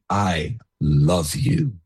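put-on father comforting his child after discipline, tenderly; tender I love you. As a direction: say it gently, slowly, from low down. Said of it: "without saying".

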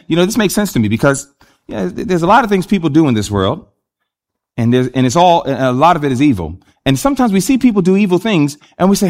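ordinary talk

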